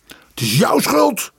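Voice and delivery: slow, high-pitched accusation; crying out in blame. A narrator's reading. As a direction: turn on her with a drawn-out outburst. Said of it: "riep Tjerajev tegen haar". (verwijtend uitroepen)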